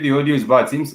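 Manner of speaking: ordinary talk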